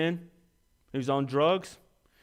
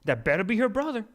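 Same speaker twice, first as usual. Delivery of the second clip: high, snide gossip; gossiping church lady; 7 semitones higher than usual. Retold or imitated. Church members gossiping about a teen mother, high snide voice. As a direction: cluck over her like a prying old lady. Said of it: "all the people around me going".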